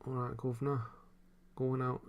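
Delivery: half-hearted East End London lilt; London accent. Shown as a demonstration. Streamer retrying a line to show the failing London accent. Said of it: "the Southern London accent".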